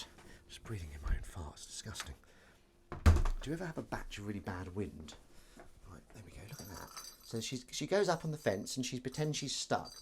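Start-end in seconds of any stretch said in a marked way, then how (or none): 0.0-2.1 low, hushed, breathy aside; whispered groan at the smell; disgusted whisper. Real, not acted. 5.9-6.9 soft hushed "look at that"; quietly pointing out the kitten; whisper. Real, not acted. Whispering near cat.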